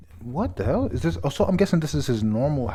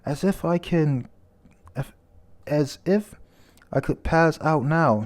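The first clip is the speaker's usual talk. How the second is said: yelling; 5 semitones higher than usual. Retold or imitated. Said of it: "she's yelling at them".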